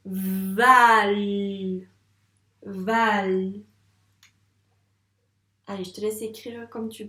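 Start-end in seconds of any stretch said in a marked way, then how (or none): 0.1-3.5 Val lancé fort et appuyé; syllable dictation. Shown as a demonstration. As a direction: marteler Val. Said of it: "on va écrire val". (syllabe Val dictée fort)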